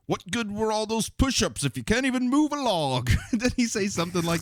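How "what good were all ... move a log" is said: imitating Alfred. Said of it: "all that Alfred does is".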